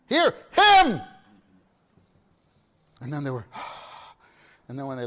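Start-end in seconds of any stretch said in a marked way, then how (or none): 0.1-1.0 loud, high command; commanding shout. Retold or imitated. The Father correcting distracted disciples, a loud high command. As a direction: order them to listen, full force. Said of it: "the father was like".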